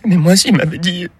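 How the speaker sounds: widow's voice. Retold or imitated. Widow explains at the funeral, high mock woman's voice. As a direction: play the grieving French wife, high.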